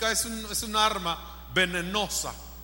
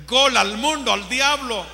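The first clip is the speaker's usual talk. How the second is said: loud list climbing to a shouted peak; building to shout; 3 semitones higher, 11 dB louder than usual. Real, not acted. Preacher urging youth against world and devil, building to shout. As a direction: hammer each item louder, ending in a shout.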